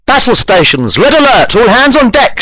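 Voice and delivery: put-on high, loud captain's command with a Russian accent; shouted Russian accent. A narrator's reading. Russian captain alerting the crew, shouted in accent. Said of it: "shouts the captain in a Russian accent".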